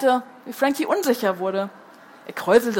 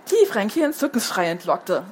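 ordinary delivery, nothing marked

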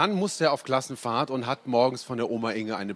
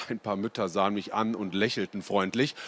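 plain reading voice

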